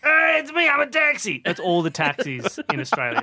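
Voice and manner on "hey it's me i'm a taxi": high, hammed-up cartoon voice; zany high-pitched character voice; talking cartoon taxi. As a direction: announce yourself like a cartoon car. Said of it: "that taxi in Who Framed Roger Rabbit".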